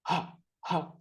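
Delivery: short, puffed "ഹ", like panting; "ഹ" as a quick burst of breath; panting h sound. Shown as a demonstration. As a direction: puff out "ഹ" as a breathy phonics sound. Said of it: "കിതയ്ക്കുന്ന പോലെ".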